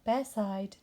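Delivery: ordinary delivery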